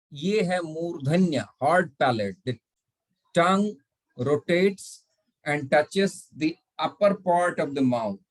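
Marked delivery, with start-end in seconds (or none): none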